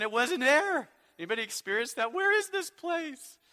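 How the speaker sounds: exasperated lost-GPS bit; mock exasperation. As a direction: act out being lost in circles. Mock lost driver's frustration.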